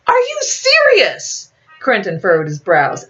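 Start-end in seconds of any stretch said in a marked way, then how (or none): 0.1-1.5 slow, high outraged disbelief; shocked outrage. A narrator's reading. Quentin disbelief.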